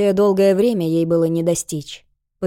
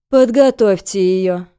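cold, slow commanding order; 6 dB louder than usual. Acted. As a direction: give the order coldly, unhurried. Cold head orders guards.

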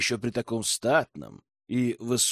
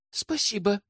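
high little sigh of thanks; understated, sighing girlish voice; 7 semitones higher, 4 dB quieter than usual. A narrator's reading. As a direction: thank him on a light sigh. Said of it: "вздохнула девушка".

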